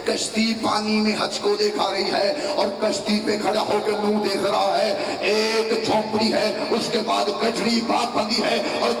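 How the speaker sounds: high, loud, impassioned preaching to the crowd; preaching at full force. Acted. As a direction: build it up and hold the crowd at full pitch. Rising climax of the majlis. (مجمع کے سامنے جوش بھرا بلند خطاب)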